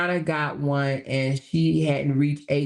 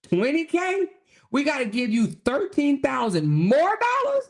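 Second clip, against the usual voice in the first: high-pitched incredulous questioning; hammed-up indignant donor; mock outrage; 8 semitones higher than usual. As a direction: balk at the figure with acted disbelief. Acts outraged donor's reaction.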